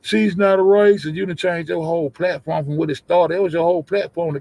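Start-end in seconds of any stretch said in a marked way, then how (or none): 0.0-1.1 imitating the defender. Streamer quotes someone defending a friend, imitating them. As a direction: throw their defence back, pitched up. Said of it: "came out this motherfucker and said".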